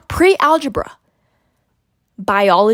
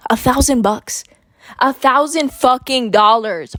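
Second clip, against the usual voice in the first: ordinary talk, nothing marked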